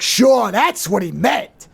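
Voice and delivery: demonstrating an angry, emphatic retort; heavily emphasized mock anger. Shown as a demonstration. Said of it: "But if I was angry".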